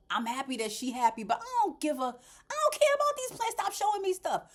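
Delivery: high, loud fed-up rant; mock annoyed past self; exasperated inner voice. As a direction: imitate your younger self, fed up. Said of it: "I would have that dead, glazed look like".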